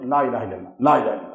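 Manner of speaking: لا الہ الا اللہ mumbled fast and low; quiet mock mumble; mocking a rushed recitation. Shown as a demonstration. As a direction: imitate a careless mumbled chant. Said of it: "اب کلمہ عام لوگ کیا پڑھتے ہیں".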